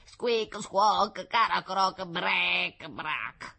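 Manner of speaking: shrieking nonsense squawks, loud and high; alien gibberish yell. Acted. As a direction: squawk out alien words in frustration. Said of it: "cominciò a urlare nella sua lingua".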